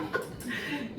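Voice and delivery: short real laugh at her own story; a quick, real laugh breaks out; burst of laughter. Real, not acted. Speaker laughing at her summer boots story, a real short laugh.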